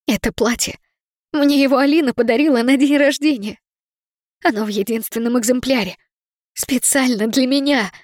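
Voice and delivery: high, distressed and shaken; upset, near tears. Acted. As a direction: hold back tears over the dress. Upset over torn gift dress.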